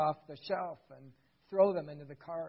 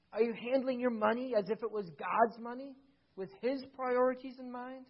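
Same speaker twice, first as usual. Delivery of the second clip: ordinary talk, nothing marked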